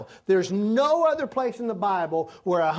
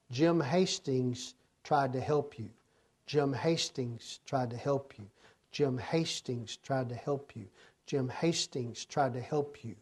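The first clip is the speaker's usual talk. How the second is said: the Lord repeating the charge, fading softer; fading refrain; 4 semitones lower, 6 dB quieter than usual. Acted. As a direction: let the accusation echo and fade.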